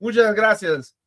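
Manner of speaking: put-on high, loud Spanish accent thanks; playful Spanish accent.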